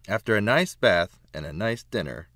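usual speaking voice

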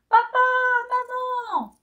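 lost at sea, calling out for mum and dad; little hen lost at sea screams for her parents; desperate scream. Acted. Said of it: "hurle la petite poule".

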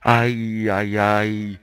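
low, loud, drawn-out groan; long, low wail of dismay. Real, not acted. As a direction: groan in dismay at a bad roll. Groans at the dice result.

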